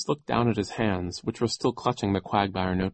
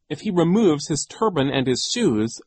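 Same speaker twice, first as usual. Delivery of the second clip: put-on louder, higher insistent girl; impatient; 7 semitones higher, 5 dB louder than usual. A narrator's reading. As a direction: press the point impatiently. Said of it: "Violet said impatiently".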